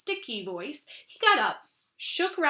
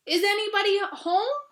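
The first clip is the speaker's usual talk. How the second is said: louder and higher call into the hole; calling very loudly; 7 semitones higher, 4 dB louder than usual. Acted. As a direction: shout it so they must hear this time. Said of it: "called out Pooh very loudly".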